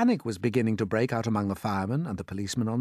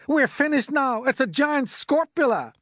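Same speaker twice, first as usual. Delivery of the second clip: high, loud wail of doom; panicked yell; 5 dB louder than usual. A narrator's reading. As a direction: yell it as all is lost. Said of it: "yelled the head of the fire department".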